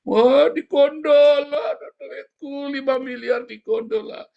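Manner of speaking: high, slow mock lament over stolen money; hammed-up money lament; mock wailing loser. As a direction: play the whiner losing his cash. Mimics someone wailing over money.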